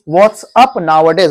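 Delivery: "what's up nowadays" stretched out very slowly; slow model. Shown as a demonstration. Teacher modelling the greeting slowly for Bhagat Singh. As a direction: drag out "what's up nowadays" for the child to copy. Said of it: "बोल के दिखाओ".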